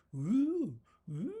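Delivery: imitating a teasing hooter; teasing hoots. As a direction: hoot at her playfully. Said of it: "he's all like".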